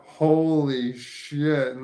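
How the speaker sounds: slow, loud, stretched holy shit; drawn-out exclamation. Real, not acted. Stoned vlogger marvels at forgetting to drink, loud and slow. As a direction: let it hang in disbelief.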